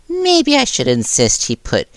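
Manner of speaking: high, light Nezu voice. Acted.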